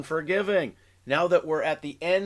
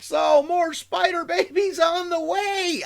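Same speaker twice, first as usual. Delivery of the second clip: excited shout; 12 semitones higher, 3 dB louder than usual. Real, not acted. Excited sign-off.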